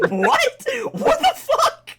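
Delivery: loud, high disbelief breaking into laughter; mock shocked outburst. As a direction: exclaim it in hammed-up shock. Acting the others' shocked reaction.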